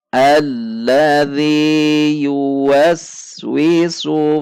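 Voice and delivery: very slow melodic chanted tartil; Quran recitation. Shown as a demonstration. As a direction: chant each word with long pauses. Teacher recites verse again.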